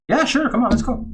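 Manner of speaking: put-on playful voice for the kids at the field; eager friendly yes. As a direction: invite the kid in with a big happy lift. Agreeing to target practice.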